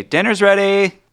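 loud, high call from the farmhouse. Acted. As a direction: holler everyone in to dinner. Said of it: "a voice call out from the farmhouse".

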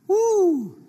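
a high whooping woo; excited woo. Real, not acted. Preacher whooping to the congregation, a high excited woo. Preacher exclaims mid-sermon.

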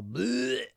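high-pitched comic "blech"; mock retching. Host warns listeners about nasty comments, mock retch.

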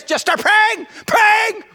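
high, loud exhortation; urgent shout. Shown as a demonstration. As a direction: shout it over an argument. Preacher urging over imagined marital fight.

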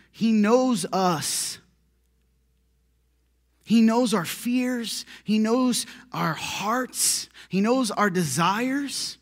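slow, weighty, each line set apart; slow preaching emphasis; slow emphatic. Real, not acted. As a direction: lay each line down slowly. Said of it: "slow emphatic preaching".

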